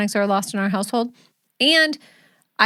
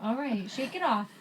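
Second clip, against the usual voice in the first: much softer, higher, gentle mom voice; 5 semitones higher, 12 dB quieter than usual. Real, not acted. Soothing crying daughter.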